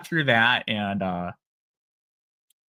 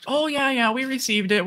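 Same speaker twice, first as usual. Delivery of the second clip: mock-cheery rep voice, much higher; chirpy phone rep; 14 semitones higher than usual. Imitating the cheery bank rep answering his call, much higher. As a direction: play the overly bubbly bank rep on the line.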